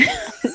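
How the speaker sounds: bright high burst of laughter. Real, not acted. Co-host laughing at her self-mocking joke, high burst of laughter.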